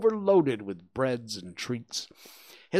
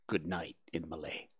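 ordinary delivery, nothing marked

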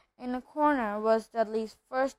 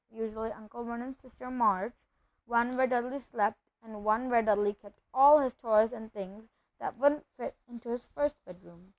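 ordinary delivery, nothing marked

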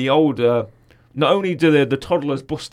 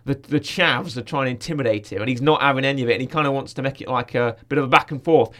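ordinary talk

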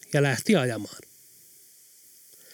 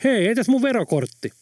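calling out; 8 semitones higher than usual. A narrator's reading.

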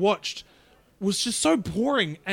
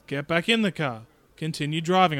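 ordinary delivery

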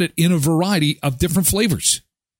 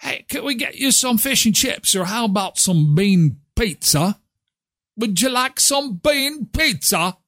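high-pitched mock British accent; Austin Powers-style British accent; 8 semitones higher than usual. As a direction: ham up a British accent, offering food. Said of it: "Have you seen the Austin Powers movie?".

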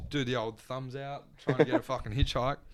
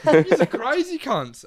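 laughing disbelief; 13 semitones higher, 10 dB louder, slower than usual. Retold or imitated. Reacting to laughing couple.

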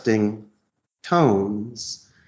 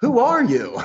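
boss demanding; 12 semitones higher than usual. Shown as a demonstration. Boss asks the caller.

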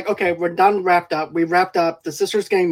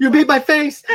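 mock horrified accusation; 8 semitones higher, 4 dB louder than usual. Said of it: "he looks through and he's like".

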